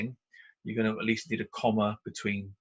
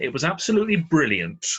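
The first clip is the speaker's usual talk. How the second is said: louder, enthused reading of the example; exclamatory delight; 5 semitones higher, 7 dB louder than usual. Shown as a demonstration. Teacher reading an exclamation example to the class, enthusiastically louder. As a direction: sell it like a real exclamation. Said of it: "definitely use an exclamation mark".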